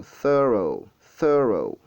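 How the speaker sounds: "thorough" in two parts, first one lengthened; stretched first syllable. Shown as a demonstration. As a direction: linger on the start of "thorough", falling on the end. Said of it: "vous étirez un peu".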